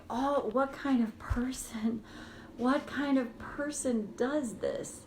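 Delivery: slow whispered lament at yourself; despairing whisper. Shown as a demonstration. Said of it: "that's how my voice sounds when I'm like that".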